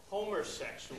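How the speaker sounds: put-on Boston accent, extra R in "homersexuals". Dad's Boston accent, "homersexuals" with an R. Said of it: "my dad's Boston accent made it".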